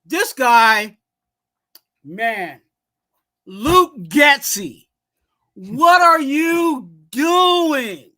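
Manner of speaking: drawn-out, loud shout of disbelief; furious fan calling out the play-caller; exasperated yell at the coach. Real, not acted. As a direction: yell it slow like you can't believe it. Ranting at the coordinator.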